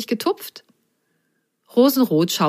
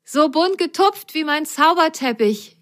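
higher, jubilant voice; rejoicing; 9 semitones higher than usual. Acted. Grasgrün rejoices at the spots, higher and jubilant. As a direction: cheer it out happily. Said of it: "juchzte Grasgrün".

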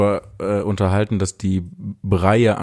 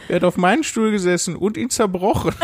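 put-on high baby bear voice; 10 semitones higher than usual. A narrator's reading. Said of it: "fragte das Bärenbaby und begann zu weinen".

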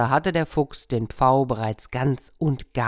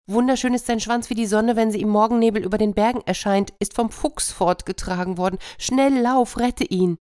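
high, fast, urgent hen; breathless hen; 8 semitones higher than usual. Acted. Hen running to the cat, fast and high. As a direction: rush it out in alarm. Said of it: "Da rannte die Henne zur Katze".

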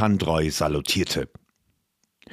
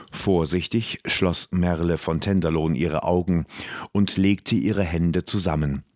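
ordinary delivery, nothing marked

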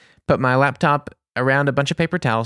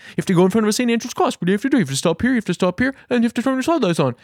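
high, rushed, exasperated listing; mock-frantic explanation at speed; rapid-fire recital; 9 semitones higher, much faster than usual. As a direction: rattle it off impatiently. Acted rapid breakdown of test question.

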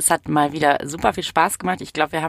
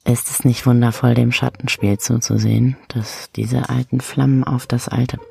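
low, eerie reading; lightly spooky story voice; creepy storyteller; 5 semitones lower than usual. Acted. Reads creepy story lines.

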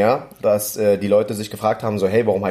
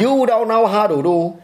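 much higher, sing-song Vietnamese-accented English; 11 semitones higher, 4 dB louder than usual. Shown as a demonstration. Comedian doing his Vietnamese character voice for his partner. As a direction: put on a heavy Vietnamese accent, pitched way up. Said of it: "Du sprichst so langsam wie so eine Vietnamesin aus Amerika".

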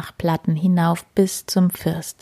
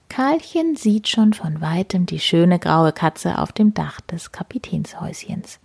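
usual speaking voice

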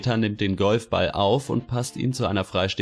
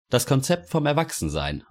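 official; 6 semitones higher than usual. A narrator's reading. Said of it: "antwortet der Beamte".

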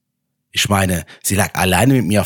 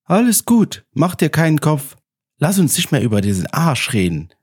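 put-on gentle young woman voice; 4 semitones higher than usual. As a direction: voice her gently and kindly. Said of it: "aber mit einer sanften Stimme".

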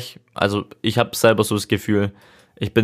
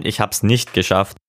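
ordinary talk, nothing marked

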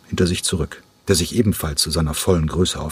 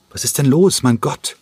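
higher, louder worried call after a friend; 7 semitones higher, 4 dB louder than usual. Acted. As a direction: call after him, alarmed. Calling after the departing Cranko. (besorgter Ruf, höher und lauter)

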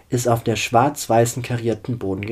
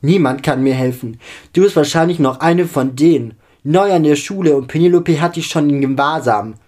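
loud, higher-pitched outburst; agitated accusation; 4 semitones higher, 8 dB louder than usual. A narrator's reading. Distraught girl accusing the new vampire, agitated. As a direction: fire off the accusation, pressing and loud.

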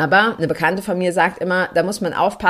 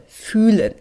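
fühlen pushed out a bit louder and higher; stressed word; 3 semitones higher, 4 dB louder than usual. Shown as a demonstration. Telling listeners to feel, not think: fühlen stressed. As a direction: lean into fühlen. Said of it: "Betonung auf spüren".

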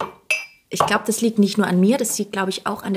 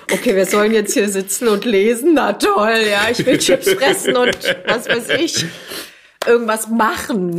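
loud, whiny bored complaint; bored kids whining; 6 dB louder than usual. Retold or imitated.

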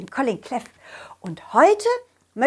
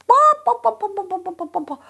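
high clucking fading away; clucking hen; 11 semitones higher, 4 dB louder than usual. Acted. Hens clucking.

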